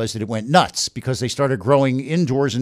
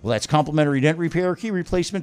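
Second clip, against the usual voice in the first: upbeat radio ad pitch; ad read; 2 semitones higher, 7 dB louder than usual. Acted. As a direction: sell it like a car dealer spot. Host reads live car ad.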